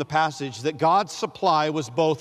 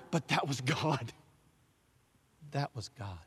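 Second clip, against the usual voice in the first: hushed; 2 semitones lower, 9 dB quieter than usual. Acted. Closing the refrain.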